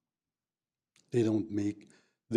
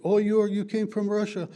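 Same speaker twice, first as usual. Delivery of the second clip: higher, fast, louder nosy questioner; higher, quick mock of a curious local; curious American; 8 semitones higher, 4 dB louder, faster than usual. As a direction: mimic the eager American asking about Russia. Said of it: "they ask you".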